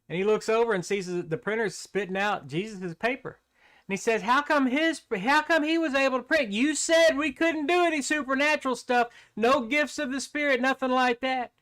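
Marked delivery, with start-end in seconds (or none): 4.2-11.5 much higher, whiny protest; the devil cries foul, outraged and pleading; indignant devil. Acted.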